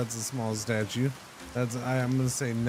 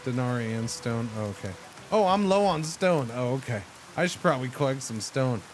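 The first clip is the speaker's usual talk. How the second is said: usual speaking voice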